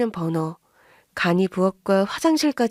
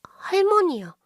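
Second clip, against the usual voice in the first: put-on small child; 10 semitones higher, slower than usual. Child names grandma.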